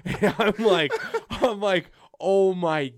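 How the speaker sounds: loud and high, laughing through it; half laughing, half shouting at the crowd; laughing disbelief. Retold or imitated. Retelling the packed airport, laughing.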